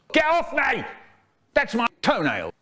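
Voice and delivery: high, piercing little squeal of protest; weedy little voice. A narrator's reading. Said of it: "In a weedy little voice".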